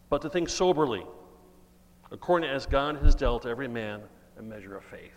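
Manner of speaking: loud, solemn reading of the verse; scripture reading. Acted. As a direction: proclaim the Bible passage to the congregation. Preacher reads scripture aloud.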